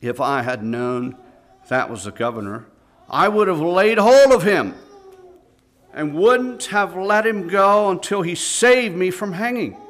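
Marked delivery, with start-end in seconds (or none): 3.1-9.8 desperate crying. Acted. Lad despairs, sobbing.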